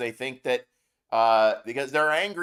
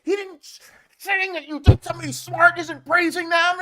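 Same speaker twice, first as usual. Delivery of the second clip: mocking; 13 semitones higher, 3 dB louder than usual.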